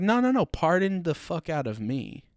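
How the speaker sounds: loud, high, mock-offended yell; sarcastic angry outburst. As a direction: snap back at fans in mock outrage. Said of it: "And they're like".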